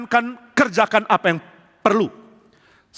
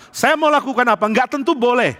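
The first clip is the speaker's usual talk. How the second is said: normal talking voice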